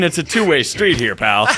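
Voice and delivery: ordinary delivery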